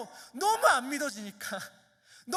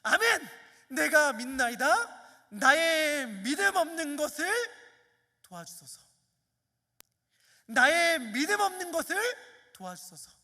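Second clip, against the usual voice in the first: louder, higher plea that sinks on each ending; crying out; 4 semitones higher, 6 dB louder than usual. Acted. Father cries out to Jesus, a loud plea that sinks at each end. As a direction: cry out your desperate prayer. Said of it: "소리를 지르면서 이렇게 말합니다".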